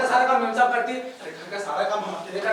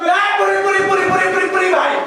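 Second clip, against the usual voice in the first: high, loud, exasperated interruption; mock angry cut-off; 7 semitones higher, 7 dB louder than usual. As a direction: shut him up, loudly. Cuts him off angrily.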